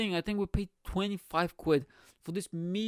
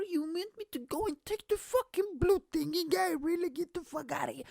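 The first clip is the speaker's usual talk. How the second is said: incredulous outrage; 10 semitones higher than usual. Retold or imitated. Outraged at waitress demand.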